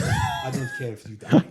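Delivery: high, loud, laughing; laughing outburst. Real, not acted. Laughing at his friend, loud and high. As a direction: squeal it through laughter.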